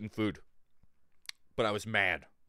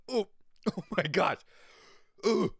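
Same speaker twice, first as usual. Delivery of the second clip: dying fish; 7 semitones higher than usual. Pretend. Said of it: "now I gotta sound like the fish".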